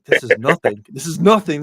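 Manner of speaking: hammed-up defensive insistence; mock insistence. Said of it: "And it's like".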